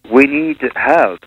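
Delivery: ordinary delivery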